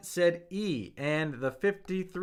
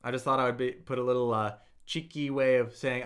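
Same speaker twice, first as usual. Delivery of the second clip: usual speaking voice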